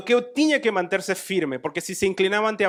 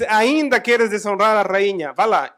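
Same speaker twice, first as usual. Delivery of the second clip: loud, slow accusing shout; the king bellows at the traitor; angry king; 4 semitones higher, 8 dB louder than usual. Acted. King catching Haman at the queen, loud and angry.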